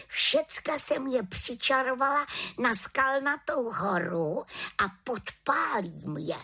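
put-on high-pitched young witch explaining her plan; pleased, high little witch voice; little witch, triumphant. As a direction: announce it proudly in a high voice.

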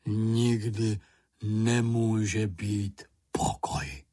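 slow, drawn-out grumbler; grumbling. A narrator's reading. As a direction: grumble it out wearily, word by word. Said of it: "zabručel Bambul". (táhle, bručivě)

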